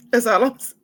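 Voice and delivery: high and loud, tearful; choking back sobs; real tears, fighting them; trying to stop crying. Real, not acted. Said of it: "I'm trying to stop".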